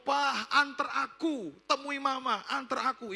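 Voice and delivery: put-on high, drawn-out begging child; imitated tearful pleading. Crying daughter begs father to take her to Mama, imitated high plea. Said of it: "anak itu langsung nangis".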